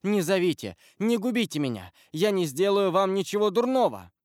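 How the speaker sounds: high, urgent begging; bewildered, pleading exclamation; distraught plea. A narrator's reading. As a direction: beg them not to give you away. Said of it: "воскликнул он, бледный, растерянный".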